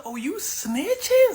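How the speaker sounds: high, slow indignant question; mock outrage. Hush Puppy confronts the partner who snitched, indignant. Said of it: "Hush Puppy's pissed".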